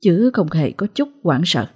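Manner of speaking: ordinary delivery, nothing marked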